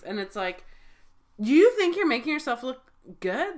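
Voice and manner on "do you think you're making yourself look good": indignant. Real, not acted. Indignant rhetorical question.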